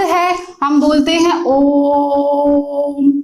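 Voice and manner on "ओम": "ओम" with the ओ held long and slow; chant-like "ओम", ओ prolonged; drawn-out vowel. Shown as a demonstration. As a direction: stretch the ओ of "ओम" well out before closing on म. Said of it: "हम जो ओ बोल रहे हैं उसका उच्चारण में हमें सबसे ज्यादा समय".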